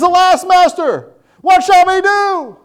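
panicked servant. Retold or imitated. Servant terrified by surrounding army.

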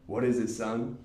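lightly voiced older man; understated play at sounding grown-up; acting older. Eighteen-year-old counselor to crying boy, acting older. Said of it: "tried to act like I was older".

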